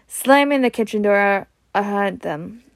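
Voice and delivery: ordinary delivery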